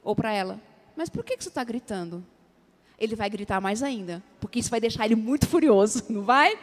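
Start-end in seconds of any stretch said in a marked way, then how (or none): 0.9-2.2 calm quiet question. Retold or imitated. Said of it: "você fala assim pra ele".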